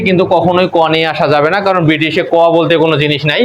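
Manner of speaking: ordinary talk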